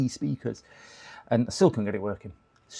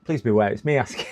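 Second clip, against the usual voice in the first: ordinary talk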